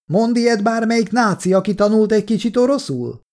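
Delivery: high-pitched challenge called from a window; yelling. A narrator's reading. Kolja throws the soldiers' words back, yelling. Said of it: "ordított ki az ablakon".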